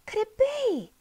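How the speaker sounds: very high, hushed hope; hardly daring to believe. A narrator's reading. Polly sees the dog coming, high and disbelieving. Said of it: "she repeated".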